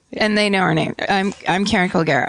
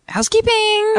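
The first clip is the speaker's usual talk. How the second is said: high silly voice. Pretend.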